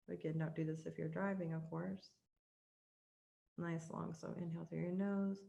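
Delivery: guided meditation. Acted. Coach guiding the host through a heart meditation, soft and hushed. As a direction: ease the listener into calm, one breath at a time.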